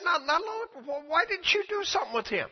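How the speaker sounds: high plea from Kenneth Hagin to the Lord; pleading question. Retold or imitated.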